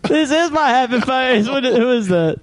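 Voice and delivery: high, drawn-out film actor impression; Tommy Lee Jones. Friend showing whom the coach sounds like, high slow actor impression. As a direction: stretch it slowly in a put-on high voice. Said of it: "He sounds like".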